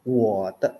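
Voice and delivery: WO only falls, then TE; low falling WO before TE; half third tone. Shown as a demonstration. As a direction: drop WO low without lifting it. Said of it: "turun doang".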